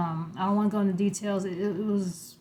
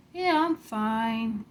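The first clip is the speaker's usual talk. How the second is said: blank zombie; 3 semitones higher, much slower than usual. Retold or imitated. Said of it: "like a mindless zombie".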